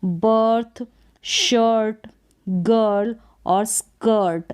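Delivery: each word said slowly and clearly as a model; careful English example words; English word drill. Shown as a demonstration. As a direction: model pronunciation for learners. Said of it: "अ का उच्चारण होगा".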